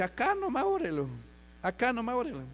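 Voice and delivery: very high imitated woman's voice; pleading daughter. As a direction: re-enact her urging in a high pitch. Voices the daughter urging prayer here.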